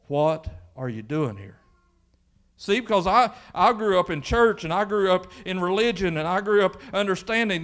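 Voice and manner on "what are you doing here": low, measured question as God; lightly voiced God, lower in pitch. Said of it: "God speaking and him saying".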